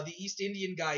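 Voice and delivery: ordinary delivery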